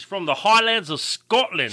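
hammed-up Scottish accent; bad Scottish accent. Introducing the new listener to co-host, bad Scottish accent. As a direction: have a clumsy go at a Scottish accent. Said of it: "probably the worst accent ever".

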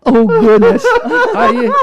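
loud, high mock gasp of dismay; exaggerated horrified exclamation; imitated shock. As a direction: act out the shocked flight attendant. Imitates aeromoça's shocked reaction.

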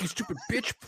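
mock insult through laughter; fake-mean line while laughing; hammed-up insult cracking up. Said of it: "he's like".

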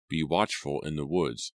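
ordinary delivery, nothing marked

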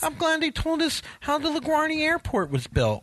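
high, dreamy gawking traveler; oblivious tourist voice. Retold or imitated. Mocking dawdling airport walkers.

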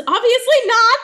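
high, loud, exaggerated indignant retort; mock outraged yell. Co-host voices Bonnie snapping after nearly dying, mock outraged yell. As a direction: blurt it out in hammed-up outrage. Acted outraged reply in recap.

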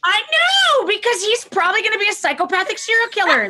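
high, anxious voice of the worried mind; panicked inner voice. Retold or imitated. Therapist voicing a new parent's inner panic, high and anxious. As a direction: voice the fear running in your head. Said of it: "that is what's happening in your head".

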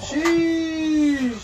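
loud, high, amazed shout of Sheesh; excited shout. Real, not acted. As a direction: yell it out, blown away by the food. Reacting to the triple stack.

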